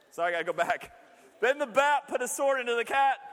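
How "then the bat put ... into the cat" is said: storybook reading. Acted. Rereads the fable.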